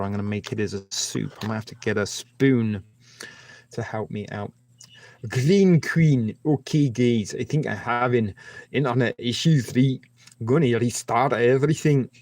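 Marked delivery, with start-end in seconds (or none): 5.3-12.1 louder, hammed-up Scottish accent; terrible Scottish accent. Streamer telling viewers about an internet issue, in a terrible Scottish accent. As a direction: put on a bad Scottish accent. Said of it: "that was a terrible Scottish accent".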